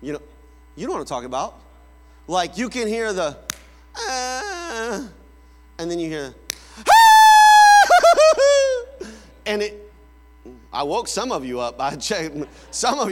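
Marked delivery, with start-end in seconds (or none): 4.0-5.1 fake cry. Preacher showing the crying parents ignore, whiny fake cry. 6.8-9.1 sudden very loud, high sustained shriek; piercing scream. Retold or imitated. Preacher showing the cry parents run to, piercing scream. Imitates child's real cry, startles congregation.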